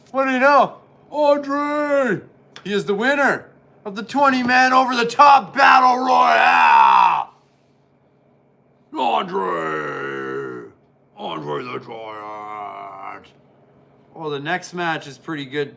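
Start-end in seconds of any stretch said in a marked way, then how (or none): 0.1-7.3 much higher, much louder announcer bellow; ring announcer shout. Pretend. Vlogger playing announcer calls the battle royale winner, booming shout. 9.0-13.3 long drawn-out name, fading low and slow; announcer call. Pretend. Vlogger announcing Andre the Giant, slow drawn-out call.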